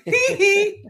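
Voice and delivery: [name] impression. [name] squeal imitation.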